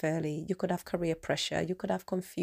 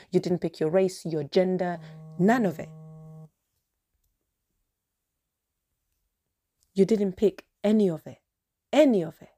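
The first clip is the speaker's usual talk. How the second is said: louder and louder, pleading intensity; passionate outburst; 5 dB louder than usual. Real, not acted. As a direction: build to an impassioned climax. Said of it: "So if I'm sounding extremely passionate".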